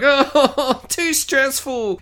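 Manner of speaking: hammed-up fretting girl voice; mock stressed girl. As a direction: sigh it like a dramatic teen girl. Girl voiced, holding her head.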